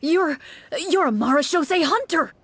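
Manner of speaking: anxious man overjoyed the hunter arrived; astonished delight. Acted. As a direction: gasp it out in disbelief and joy. Townsman excited at hunter.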